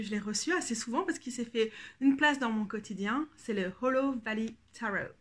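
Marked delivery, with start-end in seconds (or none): 3.8-5.1 Hollow Valley Tarot said in an English accent; English-accented title; English pronunciation. Shown as a demonstration. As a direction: say the deck's title with English sounds. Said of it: "on va dire avec l'accent français".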